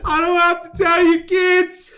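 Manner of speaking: high, loud motivational speaker yell; Matt Foley voice. Pretend. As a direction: bellow at the kids like Matt Foley. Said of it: "Chris Farley motivational speaker impression".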